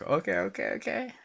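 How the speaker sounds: mock nervous agreement, pitch rising sharply; imitating his anxious drunk self; nervous okays.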